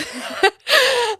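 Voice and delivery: high burst of laughter; high-pitched giggle; laughing. Real, not acted. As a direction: burst out laughing at your own story. Laughs at dad's retold line.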